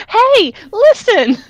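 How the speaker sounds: very high, slow and squeaky; tiny dragon voice. Acted. Tiny dragon calls out, high and squeaky. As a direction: chirp it high like a fairy. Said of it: "She turned into Fluttershy".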